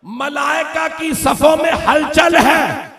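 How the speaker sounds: high, loud preaching wail; loud lament. Acted. Preacher telling of Gabriel and the angels to mourners, loud wail. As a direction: proclaim the angels' grief in full voice.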